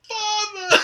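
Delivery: high, loud comic cry; hammed-up joyful recognition. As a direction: overplay a long-lost reunion. Tom recognises his father.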